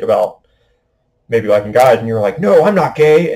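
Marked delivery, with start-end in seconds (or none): 2.4-3.3 higher and louder, a quick insistent no; a mock-defensive denial. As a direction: blurt it out like someone caught off guard.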